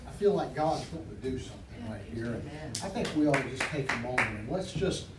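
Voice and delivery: soft, low, fast prayer murmur; hushed prayer. Acted. Pastor opening service prayer to God, hushed and soft.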